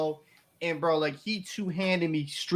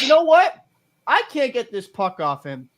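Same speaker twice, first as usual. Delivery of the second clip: mock cocky taunt; 6 semitones higher than usual. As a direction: sneer it like the cocky guy hacking at you. Quoting the dirty hockey player.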